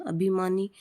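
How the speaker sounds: ordinary talk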